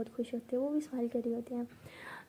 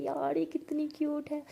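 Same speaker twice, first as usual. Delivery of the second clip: higher-pitched adoring coo; delighted gushing; 4 semitones higher than usual. Acted. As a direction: gush over how cute they are. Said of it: "इतना खुश हो रही होती है". (लाड़ भरी, खुश आवाज़)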